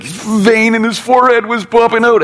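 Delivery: a giddy high-pitched jeer at the sleeping king; mocking soldier voice. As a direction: squeal it like a jeering soldier. Soldier mocks Saul, high voice.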